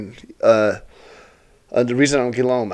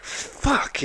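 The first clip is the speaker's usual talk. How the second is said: breathy whispered high fuck; whispered curse; 14 semitones higher, 9 dB quieter than usual. Retold or imitated. Host losing a booking's money, whispered curse. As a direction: hiss it under your breath.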